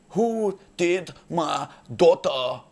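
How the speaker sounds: slow, heavy, drawn-out demand; menacing thug voice. Retold or imitated. Angry father confronting the comic, slow and menacing. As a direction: loom over him slowly.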